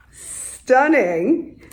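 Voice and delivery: ordinary delivery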